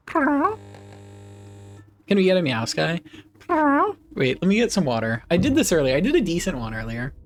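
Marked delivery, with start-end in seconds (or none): none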